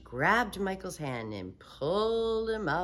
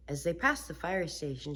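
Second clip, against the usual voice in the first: usual speaking voice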